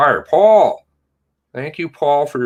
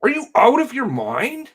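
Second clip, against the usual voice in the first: outraged; 13 semitones higher than usual. Real, not acted. Outraged at the police chief.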